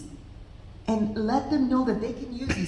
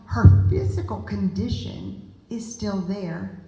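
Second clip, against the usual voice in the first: usual speaking voice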